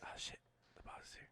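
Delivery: hushed stage whisper of alarm; mock whisper. Warning her co-host the boss arrived, a mock whisper. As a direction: whisper it like you're caught slacking.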